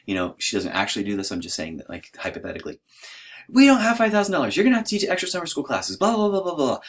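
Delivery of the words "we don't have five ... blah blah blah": high, rushed, hammed-up scolding; high-pitched frantic mock rant; mock yelling wife. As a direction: imitate a wife's tirade, starting loud, trailing into blah-blahs. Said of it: "So she's yelling at me".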